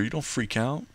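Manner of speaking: ordinary talk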